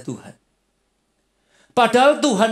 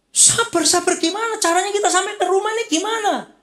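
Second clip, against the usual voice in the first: much higher, exasperated pushback; exasperated retort; 13 semitones higher than usual. Acted. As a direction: throw the advice back, fed up and fretting. Impatient spouse snapping back.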